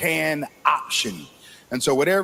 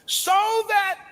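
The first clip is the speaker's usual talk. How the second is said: high, ringing sermon delivery; full-force preaching; 7 semitones higher than usual. Acted. Preacher warning the congregation of dangerous times, ringing. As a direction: preach the last days with fire.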